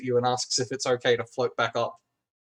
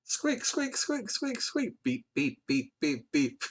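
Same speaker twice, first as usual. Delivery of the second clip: droid beeps; 8 dB quieter, slower than usual. Acted. GM voices droid beeping.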